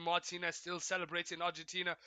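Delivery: usual speaking voice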